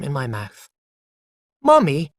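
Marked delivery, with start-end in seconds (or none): 1.6-2.1 a bright, louder call of Mummy; happy child calling his mother. Acted. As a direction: call out gladly to wake her. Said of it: "I said loudly and happily".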